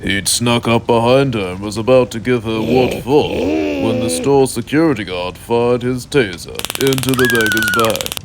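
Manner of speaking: ordinary talk, nothing marked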